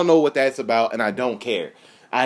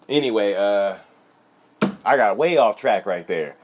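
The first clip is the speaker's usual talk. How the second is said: usual speaking voice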